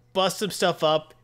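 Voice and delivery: usual speaking voice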